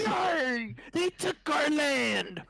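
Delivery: lightly voiced pirate, high and slow; mock pirate. Joking about becoming pirates, mock pirate. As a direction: ham up a pirate growl.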